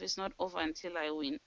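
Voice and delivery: quiet self-talk. Shown as a demonstration. Telling herself the fight goes on, quietly. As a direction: murmur it quickly to yourself. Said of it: "I say to myself".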